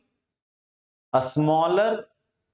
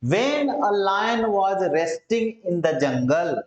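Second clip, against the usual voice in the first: slow reading; much slower than usual. Shown as a demonstration. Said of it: "थोड़ा अलग style में".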